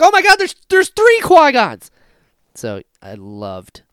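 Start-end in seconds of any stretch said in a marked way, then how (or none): 0.0-1.9 high, loud, thrilled; excited shout. Retold or imitated. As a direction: shout it in delight. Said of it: "I was like".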